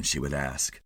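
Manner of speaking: plain reading voice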